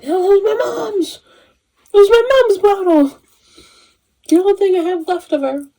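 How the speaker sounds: high, loud panicked wail; imitating Elphaba freaking out. Imitating Elphaba over her mom's bottle, high panicked wail. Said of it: "Elphaba's, like, freaking out".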